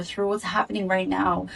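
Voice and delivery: usual speaking voice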